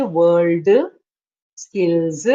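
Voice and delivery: low "world" and "skills" with a tacked-on "uh"; imitating stressed endings. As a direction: mimic the learner, adding a vowel after "world" and "skills". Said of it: "like you say".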